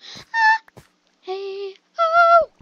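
shrill high shriek, loud; startled scream. Real, not acted. Gamer shrieking at a sudden attack in the game. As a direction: scream in sudden real fright.

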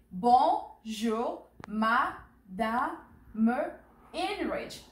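"Bonjour, Madame" in slow, separated syllables. Shown as a demonstration. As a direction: model "Bonjour, Madame" one syllable at a time for repeating. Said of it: "So repeat after me".

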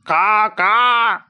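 slow, high cawing crow call. A narrator's reading. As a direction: caw it out like a crow. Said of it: "అని అరగమంది". (కాకిలా ఎత్తైన స్వరంలో కా కా)

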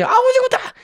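very high squeaky gibberish voice; mock Korean babble. Narrator imitates the Korean mother's reply in a very high squeal. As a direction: parrot foreign chatter in a tiny squeal.

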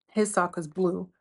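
ordinary delivery

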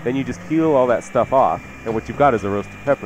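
ordinary delivery

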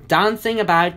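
plain reading voice

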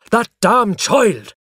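loud, high, slow exasperation; irritated father. A narrator's reading. Father woken by his screaming son, exasperated. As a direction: burst out in drawn-out annoyance.

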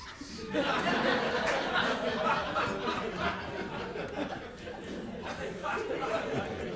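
high, breathy laugh at own punchline; high breathless laugh. Real, not acted. Teller laughs at his own horse joke, high breathless laugh. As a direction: break into silent laughter.

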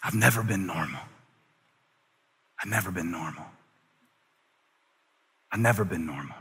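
dropping to a near whisper; soft, heavy, grieving quote; quiet grief. Retold or imitated. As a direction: voice sinking low and soft. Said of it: "he was saying".

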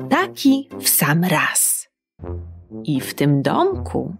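ordinary delivery

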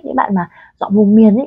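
usual speaking voice

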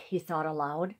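plain reading voice

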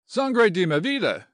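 high, loud Spanish outburst; shocked exclamation. Acted. General hears his good friend insulted, exclaiming in shock. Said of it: "exclaimed the general".

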